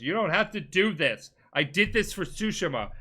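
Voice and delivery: impassioned retold protest. Jin protests to his uncle before their duel, impassioned.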